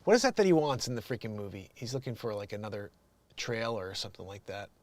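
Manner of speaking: ordinary talk, nothing marked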